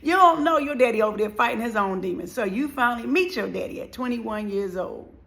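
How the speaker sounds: normal talking voice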